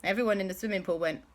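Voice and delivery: usual speaking voice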